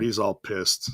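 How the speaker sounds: ordinary delivery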